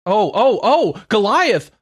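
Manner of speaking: slow, high, thrilled exclamation; drawn-out gasp of delight; excited realization. Real, not acted.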